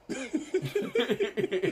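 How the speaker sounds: big laugh, pitch jumps high. Real, not acted. Laughs after retelling.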